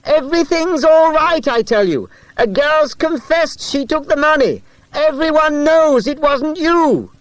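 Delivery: high, loud shout while cycling. A narrator's reading. Boy shouting to the rider he chases, loud shout. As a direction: call out with all your breath. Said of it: "was still shouting".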